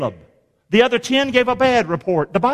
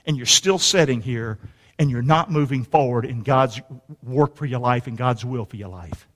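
usual speaking voice

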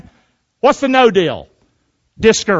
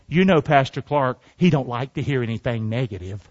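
low, softer voice of a complainer; quietly passing on a gripe; quoted church member; 8 semitones lower, 5 dB quieter than usual. Retold or imitated.

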